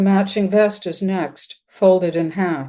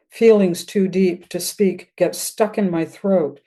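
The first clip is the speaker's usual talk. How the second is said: ordinary delivery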